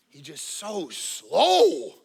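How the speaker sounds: dragged-out slow words; mock slow drawl. Preacher mocking a slow God, a drawn-out mock slow line. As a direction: stretch each word to mock slowness. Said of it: "the three mile an hour God".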